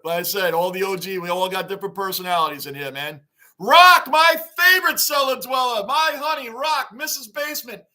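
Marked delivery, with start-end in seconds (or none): 3.6-7.8 loud, high welcome to his wife; excited loud greeting. Real, not acted. Husband on stream greets his wife in chat, loud and excited. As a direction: call out to her like she just walked in.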